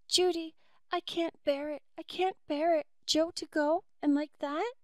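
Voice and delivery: high, tearful pleading; weeping. Acted. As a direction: plead through tears. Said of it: "Pat was weeping wildly".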